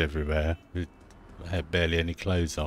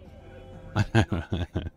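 amused laugh; real amused chuckle. Real, not acted. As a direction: laugh at what was just heard. Amused at game dialogue.

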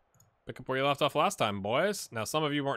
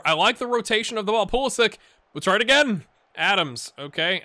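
louder, higher excited commentary; play-by-play commentary; 4 semitones higher, 6 dB louder than usual. Acted. Gamer calling the US team's highlights, excited play-by-play. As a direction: ride the rhythm of each pass.

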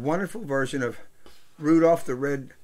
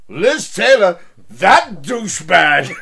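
louder, slow scoffing quote; scornful Mike; 2 semitones higher, 9 dB louder, slower than usual. Retold or imitated. Storyteller mocking Mike's scorn at the bar, loud scoff. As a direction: scoff at the name like a grumpy old bettor. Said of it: "And he goes".

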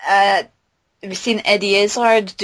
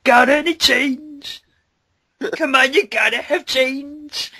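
slow, pleading beggar voice; drawn-out plea for coins; begging bum; 7 semitones higher, slower than usual. Acted. Bum in the musical begging for change, slow and pleading. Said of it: "songs in from the bum".

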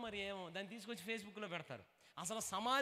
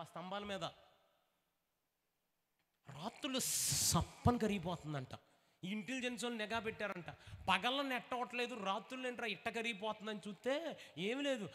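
ordinary delivery

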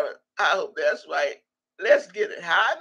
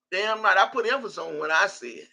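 ordinary talk, nothing marked

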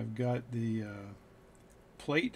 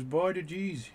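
put-on Newfoundland accent; 6 semitones higher, slower than usual. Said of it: "He's from Newfoundland, Canada".

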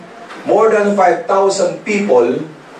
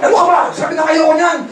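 angry shout; 11 semitones higher than usual. Retold or imitated. Manager yelling at the pushy sponsor in anger. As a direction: blow up at him. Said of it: "Galit na galit ako".

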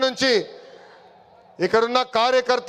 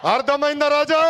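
yelled taunt at the rival, అర్థమైందా రాజా raised high; taunting shout; 4 semitones higher than usual. Real, not acted. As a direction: fling it out to roar the crowd up. Repeat shouted to crowd.

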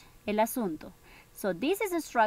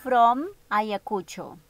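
slow reading; 5 dB louder, much slower than usual. Shown as a demonstration. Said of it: "it says".

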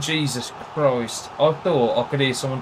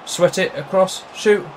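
higher and louder, urging the shot; excited shout; 6 semitones higher, 6 dB louder than usual. Real, not acted. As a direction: yell at the screen as the chance opens. Urging on a game attack.

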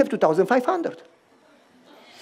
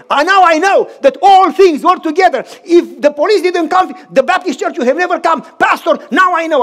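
high and loud, thrilled discovery; overjoyed friend exclaiming to the pastor; excited realization; 6 semitones higher, 6 dB louder than usual. Retold or imitated. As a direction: shout out the revelation. Friend realizes everything worked together.

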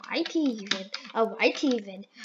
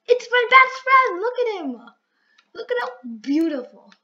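excited squeal; 8 semitones higher, 7 dB louder than usual. Real, not acted. Gushing over pet.

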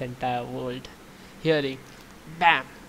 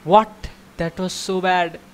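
loud, higher frustrated outburst; annoyed at himself; 4 semitones higher, 9 dB louder than usual. Real, not acted. Frustrated after bad try.

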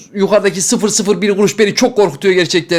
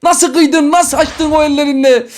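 dismayed wail; 7 semitones higher, slower than usual. Real, not acted. Reacts to losing big box.

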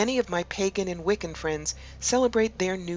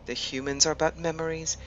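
measured meditation voice with long pauses between phrases; guided meditation; 6 dB quieter than usual. Acted. Host guiding listeners to relax and imagine an ancient wood, guided meditation. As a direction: lead them gently inward, leaving space after each phrase.

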